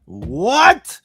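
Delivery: high, loud disbelieving what; shocked what. Real, not acted. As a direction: react like you can't believe it. Shocked reaction to innuendo.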